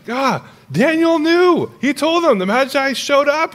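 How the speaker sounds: high, loud mock exasperation; exasperated outburst. Preacher exasperated that the disciples missed it, high exclaiming. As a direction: cry out as if they should have known.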